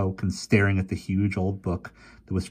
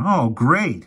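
higher, louder sarcastic exclaim; 8 semitones higher, 5 dB louder than usual. Acted. Fergie dismayed at the news, higher exclaim. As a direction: blurt out in dismay. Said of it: "exclaimed Fergie".

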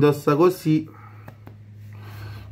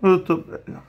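muffled behind fabric mask; through a face mask. Real, not acted. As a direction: hand pressing mask on mouth. Seen 5: Balaclava and skull half-mask cover mouth throughout; hand presses mask early.

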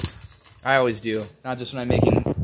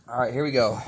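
ordinary talk

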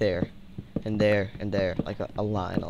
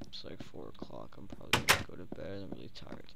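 sleepy whisper; 6 semitones lower, 13 dB quieter, faster than usual. Real, not acted. Late night, sleepy, going to bed.

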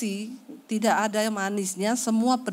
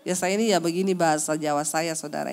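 ordinary talk, nothing marked

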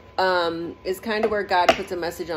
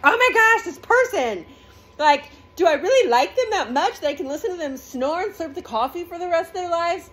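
much higher, louder mock dismay; horrified partner; 9 semitones higher, 4 dB louder than usual. Disgusted partner over snoring and slurping, in high mock dismay. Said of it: "And you're like".

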